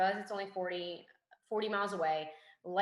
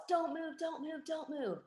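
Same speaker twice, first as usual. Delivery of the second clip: high-pitched mock yell at normal volume; high, held-back retold warning shout; retold scream; 9 semitones higher, slower than usual. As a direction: imitate an urgent yell without full volume. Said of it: "my trainer starts screaming and he starts, he yells".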